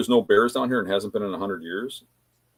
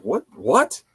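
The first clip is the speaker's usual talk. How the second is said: higher, rising mock disbelief; startled reaction; 6 semitones higher, 3 dB louder than usual. Campers react to the shot, imitated startled questions. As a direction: act out a startled double question.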